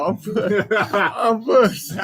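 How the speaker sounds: laughing through the words; cracking up while saying it. Real, not acted. Friend repeats the punchline while laughing hard.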